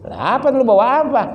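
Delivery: menirukan tuan rumah. Retold or imitated. Ceramah memerankan percakapan saudara.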